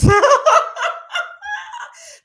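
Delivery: loud shrieking cackle; high burst of laughter. Real, not acted. Cracking up at a viewer's comment. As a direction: cackle hard at the joke.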